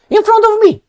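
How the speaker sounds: high, loud indignant cry; mock outrage. Teller outraged they debated in front of him, loud cry. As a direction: exclaim it in disbelief.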